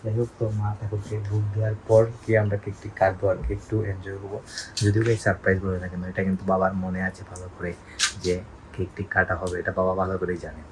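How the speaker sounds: soft, low-pitched confiding talk; hushed voice. Real, not acted. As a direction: speak quietly to keep the surprise. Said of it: "একটু আস্তে কথা বলার জন্য". (নিচু গলায়, খুব আস্তে)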